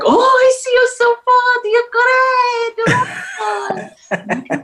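put-on high, excited Polish accent; gushing fan in a Polish accent. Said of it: "so I could do a good Polish accent".

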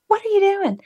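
high, shocked question; startled question. Retold or imitated. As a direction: ask it in sudden disbelief. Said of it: "And I'm like".

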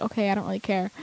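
soft, indifferent brush-off; low-key and dismissive; uninterested mom tone. Retold or imitated. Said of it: "she kinda had that tone of voice".

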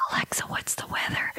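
unvoiced whisper to the speaker. Host whispering a weather question to Alexa. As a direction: ask it in a hushed whisper. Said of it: "So I went into whisper mode".

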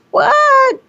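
a squeak of disbelief; incredulous. Real, not acted. Reacting to pay-to-see scam.

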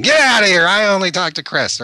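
high, loud imitation of an old lady shooing people out; mock shout. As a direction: yell them out of the bathroom in a high, loud voice. Old woman shooing visitors away.

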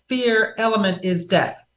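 ordinary talk

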